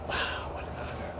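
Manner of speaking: hushed inner thought; awed whisper. Retold or imitated. Thinking to oneself about the honor, awed whisper. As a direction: whisper it softly, in awe.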